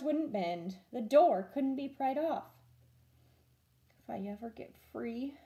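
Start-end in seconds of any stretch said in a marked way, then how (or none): 4.0-5.4 a soft, hushed whisper. Acted. Ralph whispers a promise from inside the cage. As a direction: breathe the words so no one hears. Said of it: "whispered Ralph".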